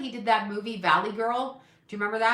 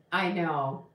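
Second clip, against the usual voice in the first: normal talking voice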